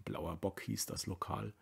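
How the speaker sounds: usual speaking voice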